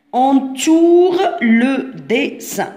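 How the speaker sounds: "Entoure le dessin" very slowly, word by word; slow dictation. Shown as a demonstration. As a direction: stretch each syllable of "Entoure le dessin" for young pupils. Teacher repeats instruction word by word. ("Entoure le dessin" ببطء شديد، كلمة بكلمة)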